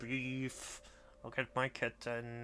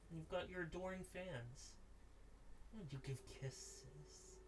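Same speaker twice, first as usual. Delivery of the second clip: softer, tender cooing to the cat; soft pet talk; 8 dB quieter than usual. Real, not acted. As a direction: murmur lovingly to your kitten. Softly loving on her cat.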